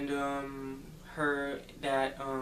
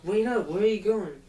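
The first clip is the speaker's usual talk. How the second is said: higher, louder acted-out call; calling after someone; 6 semitones higher, 7 dB louder than usual. Girl following him into the woods, calling after him. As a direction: call out to someone getting away.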